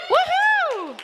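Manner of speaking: very high celebratory woohoo; excited cheer. Real, not acted. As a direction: whoop high for the winner. Cheering a raffle winner.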